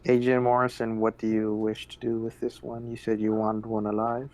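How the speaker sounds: ordinary talk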